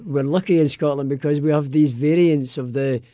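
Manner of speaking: usual speaking voice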